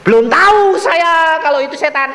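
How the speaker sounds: high, forceful outburst; loud exclamation. Real, not acted. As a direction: cry it out to the crowd. Preacher exclaims over being fooled.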